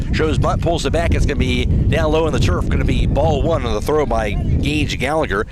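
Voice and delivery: ordinary talk, nothing marked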